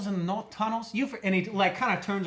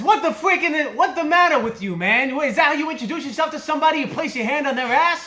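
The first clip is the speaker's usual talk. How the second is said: loud, irritated mole yelling; angry mole; 6 semitones higher, 6 dB louder than usual. Acted. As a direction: snap at him as a grumpy mole.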